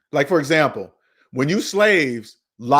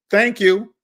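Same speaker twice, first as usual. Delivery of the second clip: mock bank thanks; 4 semitones higher than usual. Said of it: "thank you for staying in debt score".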